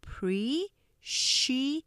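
"appreciate" very slowly, a pause between syllables; "appreciate" one separated syllable at a time; split into syllables. Shown as a demonstration. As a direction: break "appreciate" into a, pre, ci, ate. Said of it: "음절 나눠서 보시면".

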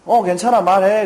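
high, loud forced calm reply; bright go-ahead. Retold or imitated. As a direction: act cheerfully fine while uneasy. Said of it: "그랬어요".

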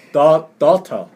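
"daughter" broken up, with a hard T; clear-T pronunciation. Shown as a demonstration. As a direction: sound out "daughter" loudly, keeping the T crisp. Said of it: "이렇게 발음해요". ("daughter"를 T 소리 살려 크게 끊어 발음)

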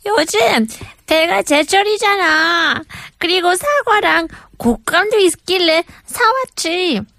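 high, fast Korean auntie voice; chatty middle-aged Korean auntie; ajumma voice. Acted. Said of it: "That was an Ajumma voice".

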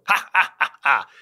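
slow villainous ha-ha; slow gloating laugh. Acted. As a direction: gloat over the spoils. Emperor gloating over loot.

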